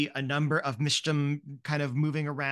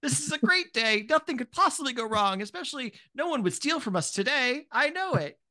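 high, sugary boss praising the workers; a much higher voice, gushing with kindly praise; overly sweet supervisor cheering on the staff; kindly manager; 9 semitones higher than usual. Acted. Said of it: "very kindly being like".

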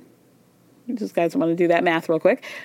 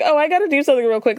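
quick, higher mock excuse; Tom brushing James off; 6 semitones higher, much faster than usual. Host imitating Tom dodging James, hurried and higher. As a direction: rattle off a hurried fake excuse. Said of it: "Tom immediately is like".

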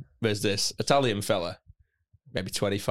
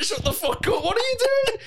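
panicked hush. Retold or imitated. Hushing mate in hostile crowd.

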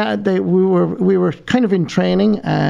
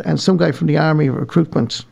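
normal talking voice

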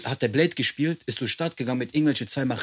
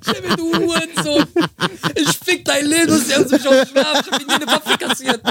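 much higher, loud ranting voice broken by laughter; laughing rant; 6 dB louder than usual. Retold or imitated. Imitating the neighbour yelling at police, high and laughing. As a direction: ham up the screaming neighbour while cracking up.

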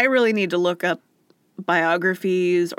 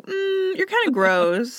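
mock snooty disdain; 12 semitones higher, slower than usual. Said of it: "And they're like".

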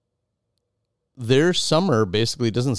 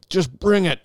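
defiant challenge; 10 semitones higher than usual. Retold or imitated.

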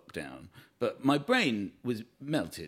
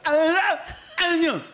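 high, loud shout with consonants lost; frozen-faced shout; 9 dB louder than usual. Acted. Father stuck mid-pitch with a frozen face, shouting. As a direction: call the name through a frozen face. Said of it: "with a frozen face, basically going".

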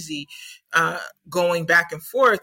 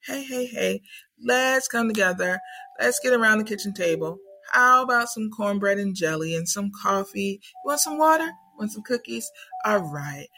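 higher, coaxing grandmotherly voice; wise ancestors; 4 semitones higher than usual. Retold or imitated. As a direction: soothe them round the kitchen table. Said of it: "the wise ancestors is like".

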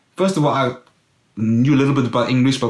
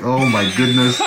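loud, raised cry of wonder; amazed exclamation; 3 dB louder than usual. Retold or imitated. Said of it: "I was like".

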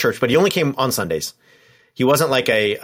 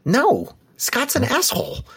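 exasperated disbelief; 10 semitones higher than usual. Retold or imitated. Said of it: "you're like".

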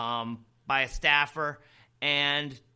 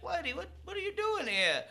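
surprised studio crew. Retold or imitated. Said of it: "They're like".

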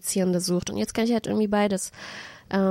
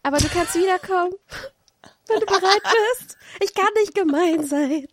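much higher, gentle and soothing, a little louder; soft gentle voice; 10 semitones higher, 4 dB louder than usual. Acted. Daydream showing the stress dream out, gently soothing. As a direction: let him down kindly, sweet and soft. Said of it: "du hast es mit so einer sanften Stimme gemacht".

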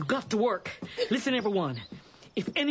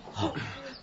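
shocked gasp; 7 dB quieter than usual. Acted. Mother sees son's beaten face.

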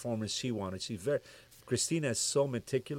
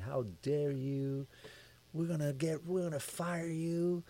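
mock angry yell; 6 semitones higher, 6 dB quieter, slower than usual. Label president threatening to fire the producer, mock angry yell. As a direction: stretch the threat out high, as a restrained mock yell. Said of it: "he's yelling at me".

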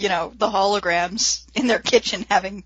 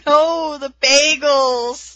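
higher, drawn-out cry of loss; mock anguish; 6 semitones higher, 3 dB louder, much slower than usual. Roxy mourning the flipped snack table, hammed-up wail. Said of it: "Roxy going".

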